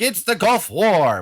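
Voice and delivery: high, loud, slow dramatic headline; mock news hype. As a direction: announce it like breathless TV news. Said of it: "the media is like".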